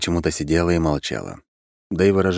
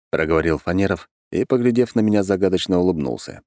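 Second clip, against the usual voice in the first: plain reading voice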